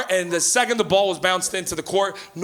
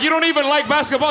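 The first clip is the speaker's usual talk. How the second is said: exasperated retort; 6 semitones higher, 4 dB louder than usual. Retold or imitated. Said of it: "I'm like".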